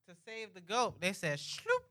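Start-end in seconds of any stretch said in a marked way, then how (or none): 1.4-1.8 high, soft "shloop" of a blade; high-pitched mock slicing sound effect; imitated slicing noise. Imitates the blade slitting the goat.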